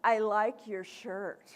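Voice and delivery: slow, grudging fake compliment; slow mock compliment; sarcastic tone. Shown as a demonstration. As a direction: let the dislike seep through. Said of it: "and tone of voice".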